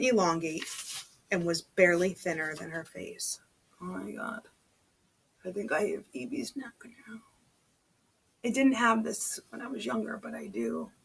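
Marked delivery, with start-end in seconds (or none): 3.8-7.2 real hushed whisper, half-joking confession; whispered aside. Real, not acted. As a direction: lean in and whisper it like a secret.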